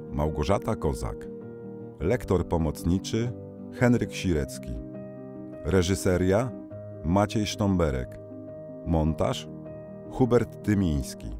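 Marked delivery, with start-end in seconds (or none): none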